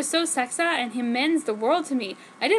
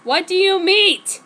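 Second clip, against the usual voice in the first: slow, loud and higher angry demand; angsty angry question; 7 semitones higher, 8 dB louder than usual. Acted. Ward angrily demanding answers, slow and loud. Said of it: "asked angstily, angrily".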